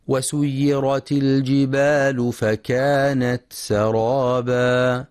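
slow, measured tajweed chant; Quran recitation. Acted. Teacher reciting the verse to be studied, slow tajweed recitation. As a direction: recite slowly with drawn-out long vowels.

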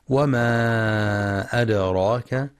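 وما أدراك with a long held aa and a bouncing d; وما أدراك recited slowly, long aa before the hamza; separated madd, qalqalah. Shown as a demonstration. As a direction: stretch the aa of وما, then echo the d in أدراك. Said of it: "للمد المنفصل وقلقلة الدال".

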